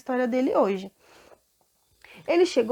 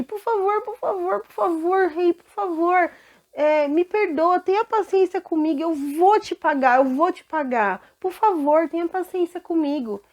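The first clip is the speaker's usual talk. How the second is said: high, pleading over and over; desperate begging; 7 semitones higher, 4 dB louder than usual. Acted. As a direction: plead for patience, near tears in tone. Servant begs king for patience. (servo suplicando ao rei por paciência, com a voz aguda)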